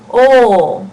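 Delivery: loud, held "O" without a glide; pure O vowel. Shown as a demonstration. Teacher leading students to say it along, loud held "O". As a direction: sustain "O" as one "aw"-like vowel. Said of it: "say it right now with me".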